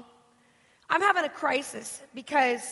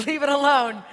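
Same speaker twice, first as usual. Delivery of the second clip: ordinary talk, nothing marked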